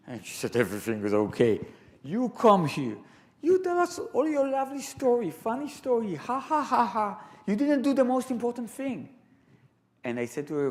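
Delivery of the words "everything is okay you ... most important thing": high, mocking scold of a grumpy old lady; mocking ha ha ha as the old woman. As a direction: mimic her sarcastic complaint, pitched well up. Elderly woman scolding the speaker.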